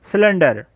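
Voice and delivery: सिलेंडर begins with a clear s, "silender"; soft c as s. Shown as a demonstration. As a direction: say सिलेंडर with an s, not a k. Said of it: "सिलेंडर में सी के बाद Y आया है".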